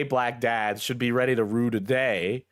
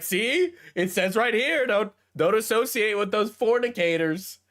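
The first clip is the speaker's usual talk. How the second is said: much higher, mocking preachy voice; preachy Bible reader; 8 semitones higher than usual. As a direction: parody a sanctimonious Bible reader. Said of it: "being like".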